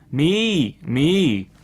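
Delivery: loud bleats; a storyteller's goat bleat. A narrator's reading. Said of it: "And the goat bleated".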